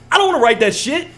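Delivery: frustrated outburst. Real, not acted. Complaining to the co-host about notes, loud and high. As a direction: blurt it out, sick of the chore.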